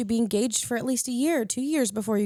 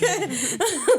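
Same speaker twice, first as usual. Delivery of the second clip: high burst of laughter; 8 semitones higher, 4 dB louder than usual. Real, not acted. Laughing at her own story.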